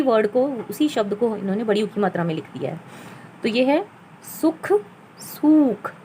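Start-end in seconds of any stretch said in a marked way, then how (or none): 3.4-5.9 सुख with short u, then सूख with long stretched ू. Shown as a demonstration. Teacher showing students the vowel length, contrasting short and long u. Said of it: "दोनों में फ़र्क पता चल जाता है".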